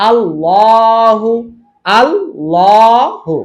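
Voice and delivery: আল্লাহু drawn out very slowly with a thick, dark double L; slow demo of আল্লাহু with full-mouthed L; heavy L in Allahu. Shown as a demonstration. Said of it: "ল মোটা".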